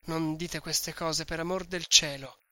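usual speaking voice

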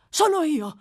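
high, loud answer; cry out. Acted. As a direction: jump to attention with a shout. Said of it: "gridò l'ebreo". (grido acuto e forte)

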